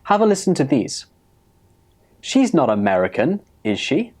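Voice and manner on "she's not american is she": checking, with "is she" lifted at the end; genuinely unsure, voice up on "is she"; rising tag question. Shown as a demonstration. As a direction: turn "is she" upward. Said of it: "so I used a rising intonation".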